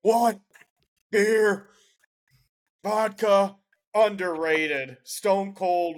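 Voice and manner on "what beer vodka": wrestler catchphrase. Retold or imitated. Stone Cold catchphrase while rating Stone Cold.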